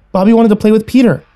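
put-on high-pitched child's voice; little sister. A narrator's reading. Little sister explains the smell to sibling, childlike.

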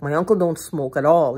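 normal talking voice

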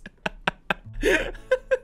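high, wheezy real laughing fit; helpless laugh. Real, not acted. Player cannot keep straight face at Fred, helpless laugh. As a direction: crack up high and breathless.

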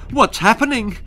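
high alarmed squeal. Acted. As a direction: squeal in panic like a mother. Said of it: "squealed her mother".

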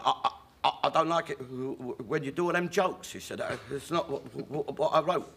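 stammering, stuck on every first word; the writer stumbles over his complaint; stuttering Johnny. Retold or imitated. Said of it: "he got this terrible stutter".

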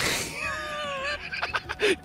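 a squealing, high laugh that breaks into a shout; high shrieking laugh. Real, not acted. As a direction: burst into a shrill squeal of laughter. Laughing at friend's joke.

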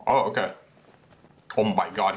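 ordinary delivery

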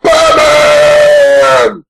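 loud chanting Batman; calling out Batman. Retold or imitated. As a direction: call out to him like a crowd. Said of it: "now they're like".

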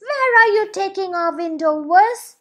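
loud, high indignant question; angry girl. Acted. Girl angrily asks the boy, loud and high. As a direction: demand it angrily. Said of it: "she asks the boy angrily".

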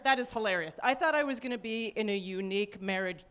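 usual speaking voice

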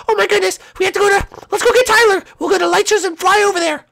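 high, squeaky cartoon voice; panicky Captain Toad. Toad rallying friends to fly to the fire, high and panicky. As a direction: squeak out an urgent plan.

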